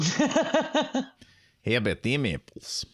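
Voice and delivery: put-on Boston accent, pitch jumping up high on "How"; a second go at the Good Will Hunting line in a Boston accent. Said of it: "That was my Boston accent".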